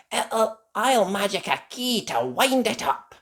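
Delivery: put-on high child character voice; fairy princess. A narrator's reading. Holly offering to wind up the robot, high voice.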